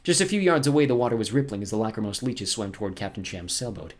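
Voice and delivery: usual speaking voice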